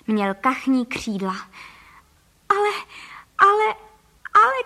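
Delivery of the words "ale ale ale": high, loud stammered yelps; shrieking in protest. A narrator's reading. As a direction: yelp each word as the outraged wife. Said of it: "křičela paní Frejková v obličeji celá fialová". (rozčileně křičet)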